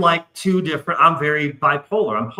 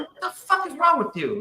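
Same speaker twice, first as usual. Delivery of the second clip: retold outrage; 13 semitones higher, 4 dB quieter than usual. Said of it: "And I'm".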